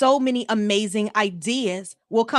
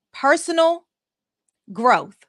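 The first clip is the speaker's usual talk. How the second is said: personal, pause, growth, each word set apart; dramatic pauses; 4 semitones higher, 4 dB louder, slower than usual. Real, not acted. Coach spelling out her key lesson to listeners, with slow dramatic pauses. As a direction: let "personal" ring out higher and louder, then drop onto "growth".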